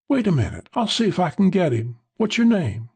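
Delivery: high-pitched woman's character voice; eager woman. Acted. Woman jumping up to fetch Ed, eager. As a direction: pitch it well up, eager to help.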